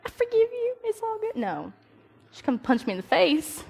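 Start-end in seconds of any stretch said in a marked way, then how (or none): 0.1-1.4 high, light "I forgive you"; mock instant forgiveness. Forgiving a punch to the face, falsely cheerful. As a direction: act way too cheerful about it. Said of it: "I'd be like".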